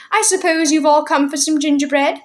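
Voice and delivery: put-on high little old woman's voice; tiny old woman. A narrator's reading. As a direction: pipe up like a tiny old shopkeeper. Mrs Corey asks, voice raised.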